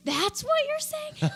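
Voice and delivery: high, slow incredulous cry; mock astonishment. Viewer finally reading the subtitles, acted astonishment.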